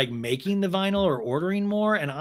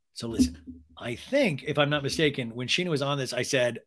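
ordinary talk

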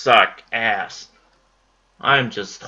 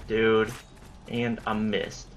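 soft, slow, deflated; resigned; 3 semitones lower, 7 dB quieter, slower than usual. Real, not acted. Gamer giving up after another miss, quietly deflated. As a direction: let it sink down in defeat.

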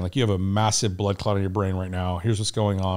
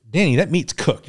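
imitated irritated insistence, pitched up; higher, mildly annoyed retort; pissy wife; 6 semitones higher, 3 dB louder than usual. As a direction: mimic a wife getting testy, voice raised in pitch. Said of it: "she's getting pissy".

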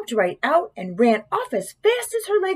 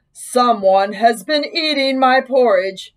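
slow, big bear voice; great big Papa Bear voice; 4 dB louder than usual. A narrator's reading. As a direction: boom it out slowly like a big bear. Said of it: "said in his great big voice".

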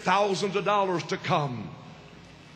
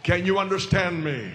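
ordinary delivery

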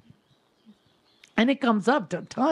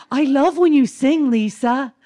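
imitating neighbor; 6 semitones higher, 6 dB louder than usual. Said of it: "And then she goes".

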